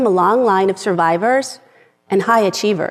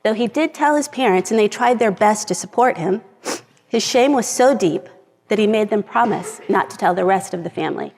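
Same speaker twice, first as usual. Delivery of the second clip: ordinary talk, nothing marked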